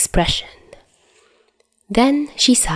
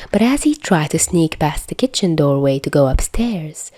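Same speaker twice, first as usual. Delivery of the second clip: usual speaking voice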